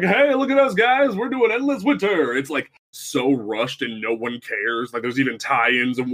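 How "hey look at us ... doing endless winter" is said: high-pitched, singled-out red-carpet boast; look-at-us fanfare, pitched way up; mock showing off. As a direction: put on a squeaky-high show-off voice.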